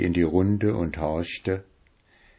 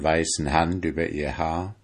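plain reading voice

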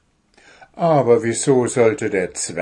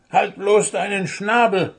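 hissing; 9 semitones higher, 4 dB louder than usual. Acted. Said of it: "fauchte Puh".

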